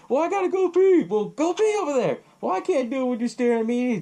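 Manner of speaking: high-pitched mock bickering; mouthing off. Father and son arguing back and forth, mock squabble. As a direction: act out a father and son sniping, pitched well up. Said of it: "they're mouthing off to each other".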